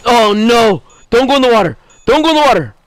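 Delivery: high, loud panicked cries at the kart; begging it to stay out of the water; panicked pleading. Real, not acted. Racer pleading with his kart over the lake, panicked and loud.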